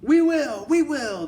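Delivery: very high, loud shrill cry; squealing witch chorus. Acted. Witches answering their leader, very high and loud. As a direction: squeal eager agreement. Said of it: "they answered".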